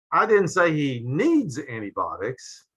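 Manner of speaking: needs lifted with a rise, slow; slow and questioning on needs; stress on needs. Shown as a demonstration. Lecturer demonstrating inflection to the class, stress on needs. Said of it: "notice how the meaning changes depending upon the inflection".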